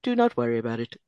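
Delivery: lightly voiced robot woman; stilted mock-robot delivery; dead-eyed robot lady. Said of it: "dead-eyed robot lady [name]".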